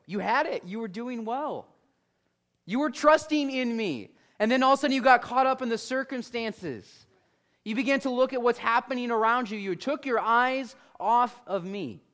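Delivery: earnest reproach. Acted. Jesus reproaches Peter, acted.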